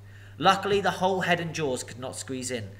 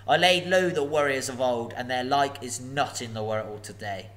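gloating dragon; 5 dB louder than usual. Acted. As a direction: savour every threat. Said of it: "he gloated".

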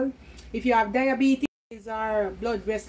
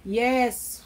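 ordinary delivery

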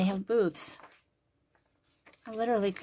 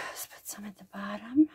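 whisper. Real, not acted. Crafter showing the page to viewers in a whisper. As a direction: breathe it as a whisper.

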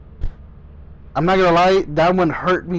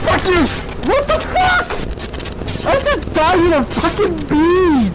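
very high-pitched, shrieked cursing; outraged yelling; 11 semitones higher than usual. Real, not acted. Player just killed by a bee, outraged high shrieking.

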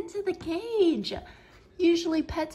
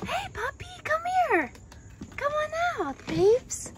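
much higher coaxing pet voice. Real, not acted. Owner coaxing the shy puppy out, high baby talk. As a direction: coax the puppy out, sing-song and high.

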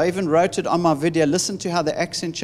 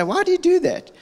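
pitched way up, baffled "why do you do that"; puzzled question. Retold or imitated. Husband quizzing his wife about how she crosses her legs, high and baffled. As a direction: ask it in high, bemused disbelief.

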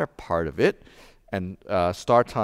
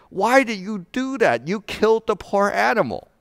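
much higher, louder retold shout; shouted at wife; 11 semitones higher, 4 dB louder than usual. Husband shouting at his wife after she shoots horse, loud high yell. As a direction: yell at her in shock over the dead horse. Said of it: "And I shouted at my wife".

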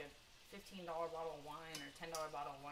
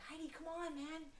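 mock begging; 8 semitones higher, 4 dB quieter than usual. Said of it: "And so I'm like".

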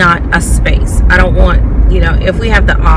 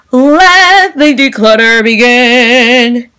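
dramatic announcement; 5 semitones higher, 4 dB louder, much slower than usual. Acted.

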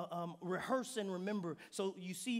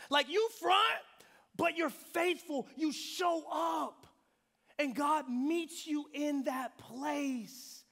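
loud, slow, rising preacher's cry; preaching at full force; 6 semitones higher, 5 dB louder, slower than usual. Acted. Preacher urging the congregation to show up, loud and slow. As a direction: lift the room with it.

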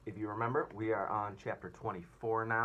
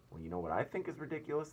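grinning tease; 6 semitones higher, faster than usual. Acted. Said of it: "grinning away as usual".

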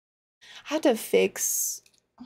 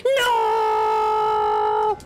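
shouting; 11 semitones higher than usual. Real, not acted. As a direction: yell in real frustration. Boss fight going wrong.

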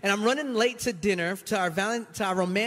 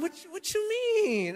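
much higher, slow incredulous question; baffled; 11 semitones higher, slower than usual. Retold or imitated. Husband hearing wife's complaint, much higher and slow in disbelief. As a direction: squeak out disbelief.

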